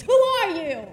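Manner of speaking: loud, high challenge called from the back; a sharp shouted challenge from the crowd; heckler shout. Retold or imitated. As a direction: hurl it at him from the back of the crowd.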